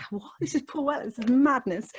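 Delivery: high, amazed "this is madness"; starstruck disbelief. Retold or imitated. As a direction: gasp it out, thrilled and stunned. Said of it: "And I was like".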